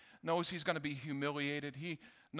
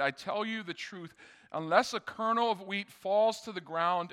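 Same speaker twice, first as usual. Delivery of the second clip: louder, measured reading of the passage; scripture reading; 3 semitones higher, 7 dB louder than usual. Acted. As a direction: read the verses out with weight. Reads scripture aloud to congregation.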